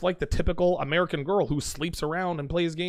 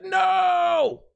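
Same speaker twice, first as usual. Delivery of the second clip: much higher, loud long cry of "No"; anguished shout; 4 dB louder than usual. Retold or imitated. Reliving the moment she disarmed him: higher, loud, drawn-out cry. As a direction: yell it like a movie hero who's been hit.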